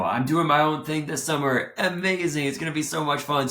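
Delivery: bright, high, bubbly self-quote; gushing about the summer plans; excited. Retold or imitated. Mock cheerful past self.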